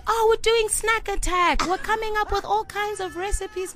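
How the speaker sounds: hammed-up squeal of enthusiasm; excited friend imitation. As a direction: mimic an oblivious child proudly announcing. Imitates oblivious friend answering the mother.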